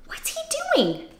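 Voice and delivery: hushed, secretive whisper. Acted. Host peeking at Leonard, whispering. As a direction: whisper so Leonard won't hear.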